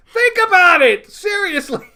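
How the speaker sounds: high, loud playful yell; mock shout.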